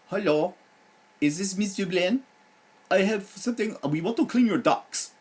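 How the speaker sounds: imitating a pushy Indian telemarketer in a bad accent. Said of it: "sorry about the bad accent".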